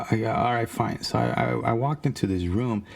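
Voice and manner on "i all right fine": plain reading voice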